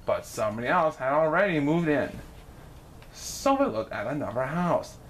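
usual speaking voice

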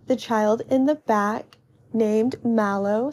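ordinary delivery

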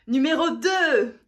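loud, drawn-out ranking call; countdown announcement. Real, not acted. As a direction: announce the next rank with a big, stretched ending. Countdown of top five accents.

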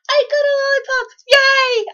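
very high, loud gleeful cry; excited squeal. Real, not acted. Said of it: "I'm so excited".

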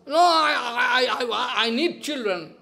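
cracked, faltering cry of a desperate king; crying plea. Retold or imitated. Childless king to the sage, crying and pleading. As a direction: stammer out the plea, half sobbing.

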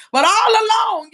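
loud outburst. Real, not acted. Ranting at viewers, sudden outburst.

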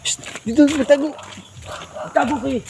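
high, loud urgent orders. Real, not acted. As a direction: push them to hide now. Hiding kids from pursuers.

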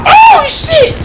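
high, loud, panicked shriek; scared scream. Real, not acted. Gamer hit by a jump scare, high panicked shriek. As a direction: jump out of your skin.

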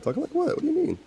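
very high-pitched, baffled questioning; incredulous questioning. Real, not acted. Streamer challenged on Overwatch opinion, incredulous and high. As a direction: shoot up high in disbelief.

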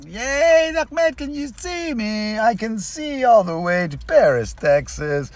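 very high, loud calls from the top; excited yelling. Retold or imitated. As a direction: shout with delight from far up. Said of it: "yelling down at him".

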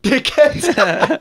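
high loud laughing yell of an insult; shouted insult. Retold or imitated. Driver shouting an insult at a pedestrian while driving off, laughing. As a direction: bark the insult out the window, laughing.

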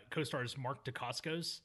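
ordinary talk, nothing marked

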